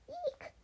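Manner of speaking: very high little "eek"; high-pitched yelp of alarm; startled squeak. A narrator's reading.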